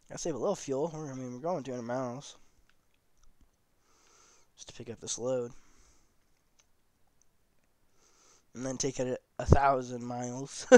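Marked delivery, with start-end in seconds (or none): 4.5-10.7 hiccups. Real, not acted. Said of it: "Got the hiccups".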